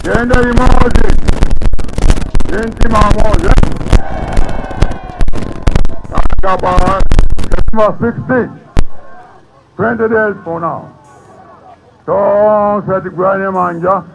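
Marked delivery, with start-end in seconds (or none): 0.0-8.5 loud political oratory; fiery rally speech. Acted. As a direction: rouse the crowd.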